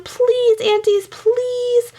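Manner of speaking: very high, slow pleading; stretched high whine of please; begging children. A narrator's reading. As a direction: drag it out like kids begging. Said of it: "begged Meg and Sean".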